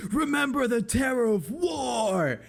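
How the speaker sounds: high, drawn-out battle cry; battle yell. Acted. Worm rider breaks through church walls, high drawn-out yell. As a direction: yell it high and slow, not at full volume. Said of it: "he just basically yells".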